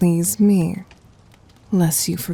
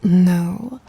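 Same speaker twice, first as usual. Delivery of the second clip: ordinary delivery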